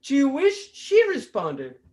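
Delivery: normal talking voice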